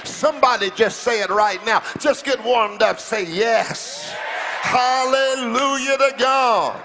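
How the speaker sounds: rally the congregation to shout along; impassioned hallelujah preaching; fervent preaching. Real, not acted.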